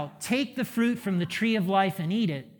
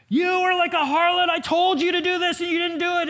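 high, loud furious outburst at a straying Israel; fuming God; 11 semitones higher, 6 dB louder than usual. Retold or imitated. Pastor voicing God scolding unfaithful Israel, furious high shout. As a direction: rage at her like a betrayed God. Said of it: "he's fuming".

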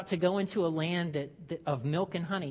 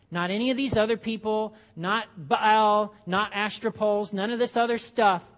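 passionate preaching; 3 semitones higher, 6 dB louder than usual. Acted. Moses preaching, building emphasis.

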